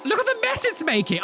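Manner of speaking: "Look at the mess it's making" blurted high in disbelief; shocked exclamation. Real, not acted. Reacting to the coffee machine spilling, high and incredulous.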